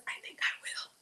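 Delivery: unvoiced little whisper to oneself; whispered aside. Real, not acted. Cook deciding aloud on a nutty addition, whispered aside. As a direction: whisper it as a private decision. Private aside while adding nuttiness.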